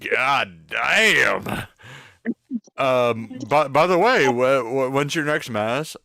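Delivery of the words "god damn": loud mock exclamation. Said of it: "I'm like".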